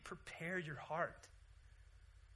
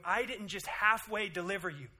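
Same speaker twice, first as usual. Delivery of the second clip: high, loud, emphatic declaration; God's voice; 8 semitones higher, 12 dB louder than usual. Retold or imitated. God reminds his people, loud and emphatic. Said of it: "He's going".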